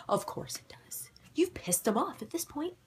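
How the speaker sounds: wry unvoiced whisper. Real, not acted. Aside about the camera dying, wry whisper. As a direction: whisper it knowingly to the viewers.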